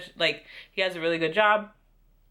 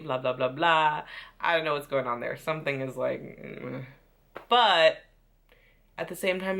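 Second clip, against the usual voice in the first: ordinary delivery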